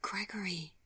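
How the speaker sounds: breathy whisper of a name; soft unvoiced whisper. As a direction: barely voice it, overcome. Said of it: "she whispered".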